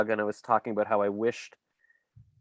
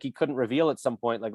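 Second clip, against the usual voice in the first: ordinary delivery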